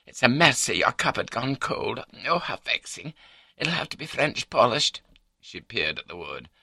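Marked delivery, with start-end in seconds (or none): 0.1-5.0 high-pitched wife fretting over a spill; fussing wife. A narrator's reading. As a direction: fuss and cluck, pitched up. Voices the wife in story.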